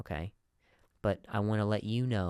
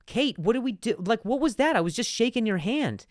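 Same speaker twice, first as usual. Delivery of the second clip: high, loud indignant outburst; alarmed protest; 13 semitones higher, 6 dB louder than usual. Acted. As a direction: play a boss in outraged disbelief. Acted boss protesting a false accusation.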